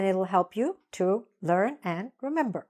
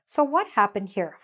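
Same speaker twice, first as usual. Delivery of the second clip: ordinary delivery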